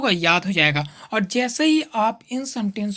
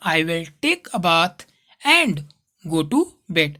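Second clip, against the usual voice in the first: slow dictation; 6 semitones lower, much slower than usual. Shown as a demonstration. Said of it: "teacher models sentence slowly".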